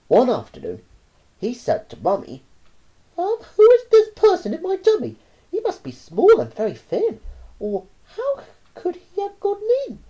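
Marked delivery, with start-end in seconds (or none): 3.2-10.0 put-on high, loud child's voice; a puzzled small boy asking; curious little boy. A narrator's reading. Boy asks Mummy about his tummy, high.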